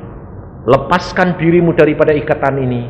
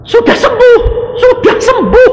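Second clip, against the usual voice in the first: much higher, loud declaration; proclaimed healing; 6 dB louder than usual. Retold or imitated. Pastor declaring the sick man healed, loud high proclamation. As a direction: cry it out as a blessing.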